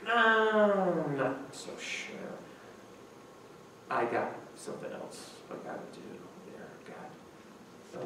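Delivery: whispered, dodging and evasive; mumbled excuse. Pretend. Preacher mocks himself dodging God, whispered excuse. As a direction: mutter it like you hope God won't hear.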